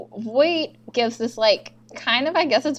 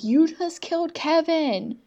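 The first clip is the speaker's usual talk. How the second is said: mild mock yell, higher and slow; retold scream; 6 semitones higher, slower than usual. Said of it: "Ruzek's just screaming the whole time".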